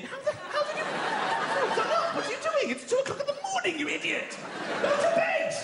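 high, incredulous rant; exasperated father. Acted. Father to son dressed at night, frantic exasperation. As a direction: build to a high, frantic scold.

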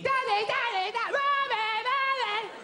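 put-on high, wailing child's cry; screaming children. Children screaming all through the night, high wail. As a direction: wail up high for mum and dad. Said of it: "they're screaming".

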